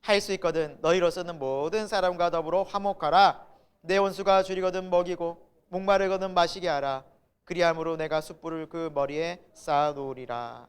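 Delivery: steady, measured, projected recitation; scripture read aloud. Real, not acted. Pastor reading the verse with the congregation, solemn projected recitation. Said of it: "우리 같이 읽어볼까요?".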